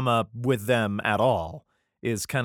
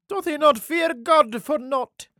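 much higher and louder, a squeaky mocking question; 5 dB louder, slower than usual. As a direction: jeer it in a high falsetto. Same verse redone in voice.

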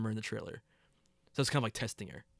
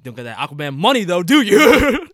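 teasing taunt; 3 semitones higher, 10 dB louder than usual. Acted. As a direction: rub it in, drawing out "do you". Teasing jab at the absent actor.

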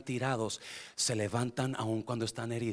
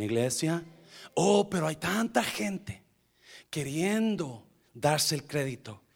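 normal talking voice

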